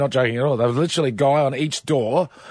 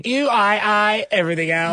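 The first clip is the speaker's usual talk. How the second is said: robot voice; 6 semitones higher than usual. Pretend. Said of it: "you have to do a robot voice".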